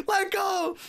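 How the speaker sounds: high-pitched insistent shout; acting out a yell up the hill; urgent calling out. Kids urge skier to drop the rope, urgent shout.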